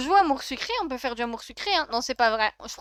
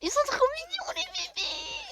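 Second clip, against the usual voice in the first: very high squeaky gushing; tiny high-pitched melting squeal; cute gushing squeal. Real, not acted. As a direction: squeal over adorable babies. Gushing over cute Sims babies.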